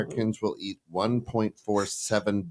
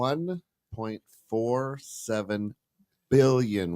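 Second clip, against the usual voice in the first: slow and deliberate, every word spelled out; over enunciating; slower than usual. Shown as a demonstration. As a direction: say each number slowly and clearly. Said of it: "Say it one more time slowly".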